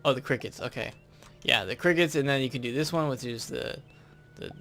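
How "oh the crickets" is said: usual speaking voice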